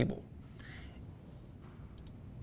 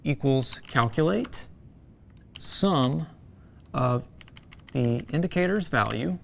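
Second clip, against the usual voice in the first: dictating; 2 semitones lower than usual. Shown as a demonstration. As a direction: read the formula out letter by letter. Reading a formula aloud.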